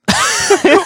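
high, loud burst of laughter. Real, not acted. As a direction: break out laughing. Joke about spokesperson role.

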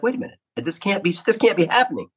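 high-pitched, retold alarm; mock panic. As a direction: squeak it out like a rookie who thinks he's in trouble. Thinks he's in trouble at 1:30am.

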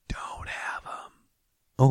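drawn-out slow whisper. Acted. Comic whispering slowly to parents-to-be. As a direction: whisper it slowly, like a secret warning.

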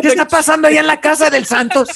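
high, fast, loud laughing Mexican relative; mock puzzled family. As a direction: rattle it off laughing.

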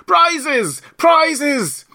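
high, loud repeated cry; clamorous calling out. A narrator's reading. Party crowding round Alice, calling out. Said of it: "calling out in a confused way".

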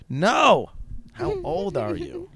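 loud, high "no", then slow high question; hammed-up exasperation, stretching the question out; exasperated demand. Acted exchange, exasperated repeated demand.

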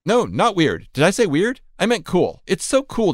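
flustered backpedal. Pretend. Backpedalling to the chatbot.

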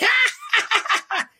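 high, squeaky mocking laugh. Executive laughing at the complaint, squeaky laugh. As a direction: cackle up high like the little executive. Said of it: "here come little squeaky dude".

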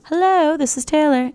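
high, singsong phone hello; eager phone greeting. Acted. Answering what she thinks is Hollywood, eager phone greeting.

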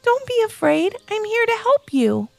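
gentle voice. Acted. Levi comforting a lost bunny, gentle. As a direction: coax a scared little one.

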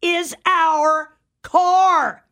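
slow, high, stubborn claim; indignant insistence. Retold or imitated. Stranger insisting to Patty the car is hers, slow and indignant. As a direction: drag it out like an offended stranger. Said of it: "looks at her angry and says".

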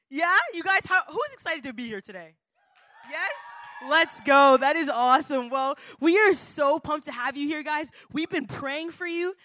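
ordinary delivery

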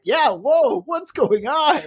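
high-pitched, acted-out astonishment; bewildered shock. Retelling own reaction to the explosion, bewildered shock. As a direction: replay your baffled reaction, voice way up.

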